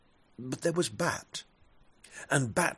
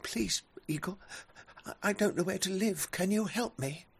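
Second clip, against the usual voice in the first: high, fast pleading bat voice; 8 semitones higher, faster than usual. Acted. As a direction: beg quickly in a thin voice. Bat begs eagle.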